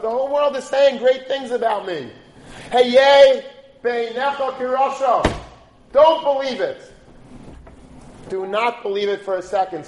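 rising, loud sermon exhortation; impassioned preacher's call; preaching at full force. Acted. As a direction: drive the point home to the congregation. Rabbi preaching the lesson.

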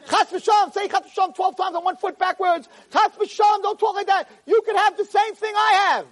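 very high-pitched, frantic protest; alarmed protest. Acted. Mother answers the girl's despair, alarmed high protest. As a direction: cry out as if warding off disaster.

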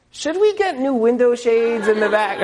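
high, put-on innocent asking voice; imitated oblivious wife. Wife raising window shades as husband rushes out, high mock-innocent voice. Wife's ill-timed question, acted out.

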